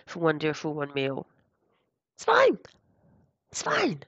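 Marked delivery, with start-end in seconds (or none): 2.2-4.0 bright high "it's fine"; high reassuring. Real, not acted. Reassuring after mess up.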